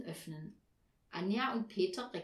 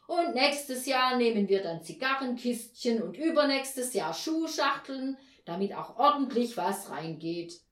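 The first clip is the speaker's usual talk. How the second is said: loud, sarcastic tirade; blustering father; 3 semitones higher, 7 dB louder than usual. A narrator's reading. Father mocks the children's demands, loud angry bluster. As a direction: rant loudly with heavy irony. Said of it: "polterte da Herr Postel los".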